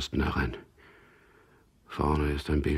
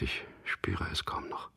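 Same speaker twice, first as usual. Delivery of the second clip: whisper; 2 semitones higher, 5 dB quieter than usual. Acted.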